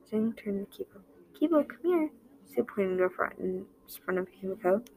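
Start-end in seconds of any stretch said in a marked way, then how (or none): 1.3-2.1 high call to the dog. A narrator's reading. Jing calling the dog over, high and bright. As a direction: call Kibo over, pitched up.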